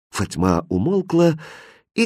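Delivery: plain reading voice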